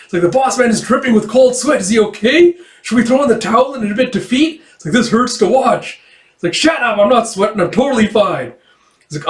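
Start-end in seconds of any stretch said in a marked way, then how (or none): 0.2-6.0 high-pitched, hammed-up worry; mock fretting. Voicing alarmed onlooker in manga retelling. 6.5-8.5 high, lively denial; mock defensive retort. Acting defensive retort in a squabble.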